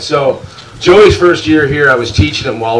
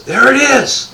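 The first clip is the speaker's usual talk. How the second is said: high, laughing cry of discovery; excited spotting; slower than usual. Retold or imitated. Matt spotting the llama from the truck, excited cry. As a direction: point and yell like you've found the beast. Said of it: "And he goes".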